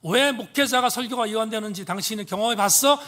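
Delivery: re-enacted offended pastor's outburst; indignant retort. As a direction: act out bristling back at the professor, loud and high. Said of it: "이렇게 빈정상했습니다".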